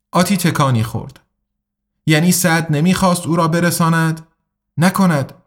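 ordinary delivery

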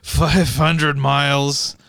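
slow, laboured phrases with breathy pauses; mock out-of-breath nasal voice; wheezy fat-guy caricature. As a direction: struggle through it, filling each pause with breathing. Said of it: "have every pause filled with".

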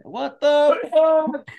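high, loud, slow drawn-out exclamation; outraged disbelief. Real, not acted. Man outraged the sick father was just drunk, slow loud disbelief. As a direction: stretch it out in shock.

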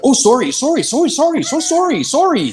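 high-pitched string of rapid-fire sorries; hammed-up, high apologetic babble; profuse apologizing. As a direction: imitate yourself over-apologizing on reflex. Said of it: "right away I was like".

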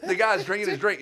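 high, loud laughing telling. Real, not acted. Telling friends the drink story, laughing high.

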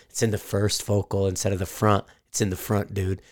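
low mumbled mockery of a know-it-all; mocking YouTube critic. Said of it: "a guy's making fun of me on YouTube".

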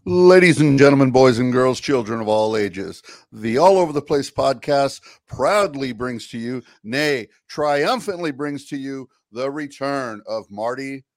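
booming circus announcer; ringmaster. Pretend. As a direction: announce him like a big top act. Grand introduction of Marty.

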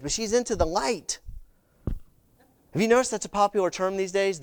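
ordinary talk, nothing marked

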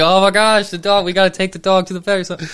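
loud, high, fast panic; panicked. Retold or imitated. Family panicking over the hurt dog, loud and rushed. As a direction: blurt it out in a rush of alarm. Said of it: "everybody's like".

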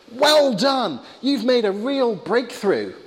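high, drawn-out congratulation; ant therapist. Acted. Therapist congratulates neurotic ant patient, high and encouraging. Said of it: "the ant therapist says".

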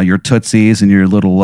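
ordinary delivery

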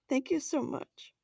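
moved to tears, laughing it off; real tearful gratitude; laughing through tears. Real, not acted. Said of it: "I'm crying".

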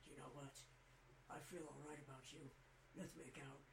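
low, hushed superhero voice; Batman. Acted. Hero confessing to Superman instead of fighting, low Batman voice. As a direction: drop deep and quiet, deadpan. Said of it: "Batman will go".

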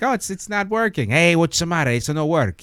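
playing a fake-confused Italian photographer, Chico style; mock Italian accent. Performer pretends the camera broke to the guests, in a hammed-up Italian accent. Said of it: "I just love hearing you go into that accent".

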